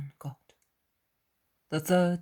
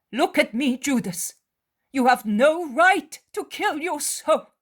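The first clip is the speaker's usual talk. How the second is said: high, loud, anguished pleading; pleading mother figure; 10 semitones higher, 7 dB louder than usual. Acted. Mary pleading with Judas to turn back. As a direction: beg him to save his soul.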